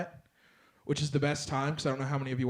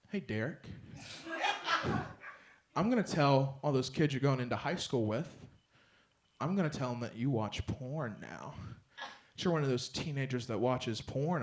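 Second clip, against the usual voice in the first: quiet threatening mom; 3 semitones lower, 9 dB quieter than usual. Retold or imitated. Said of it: "She's like".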